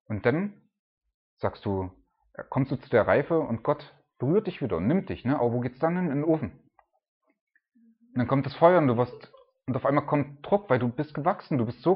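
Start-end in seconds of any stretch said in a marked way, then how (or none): none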